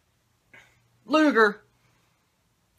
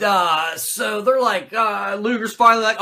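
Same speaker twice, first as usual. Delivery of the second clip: usual speaking voice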